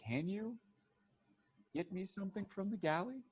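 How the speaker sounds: high, soft, halting question; tentative request. Acted. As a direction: ask very softly, pausing midway. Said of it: "And she goes".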